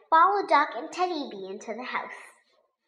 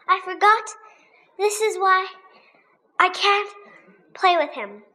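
ordinary delivery

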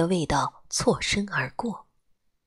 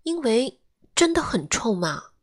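put-on higher, plaintive child's complaint; aggrieved; 6 semitones higher than usual. A narrator's reading. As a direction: whine it as a wronged kid. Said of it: "我委屈地说".

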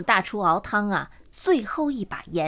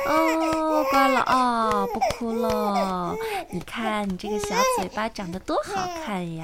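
tender cooing; 3 semitones higher, slower than usual. Acted. Woman calming a crying foundling in her arms, tender. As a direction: coo gently to hush a crying infant.

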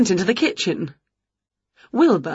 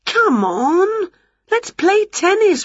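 high, excited urging; eager coaxing; 13 semitones higher, slower than usual. Acted. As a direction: coax a reluctant pet to play, pitched high. Winnie urges cat to play.

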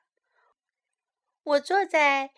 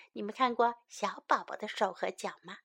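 usual speaking voice

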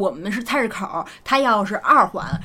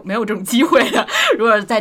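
laughing while talking, a bit louder; 3 semitones higher, 7 dB louder than usual. Real, not acted. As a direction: laugh through the words. Laughs at her own remark.

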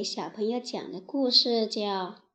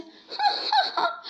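a much higher, mocking ha-ha-ha; scornful laugh. Lion laughs scornfully at the mouse's promise. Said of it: "轻蔑地笑了笑".

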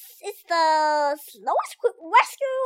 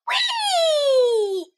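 gleeful squeal; 8 semitones higher, 3 dB louder than usual. Acted. Jumping off the pony.

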